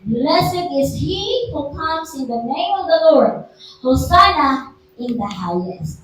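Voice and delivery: scripture reading. Acted. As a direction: proclaim the verse to the congregation. Pastor reads Palm Sunday verse.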